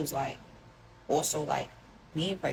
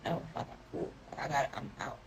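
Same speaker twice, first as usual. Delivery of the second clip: barely voiced, breathy and much softer; hushed brush-off; 3 semitones lower, 9 dB quieter than usual. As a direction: let it slip out under your breath, done with him. Brushing him off while crying.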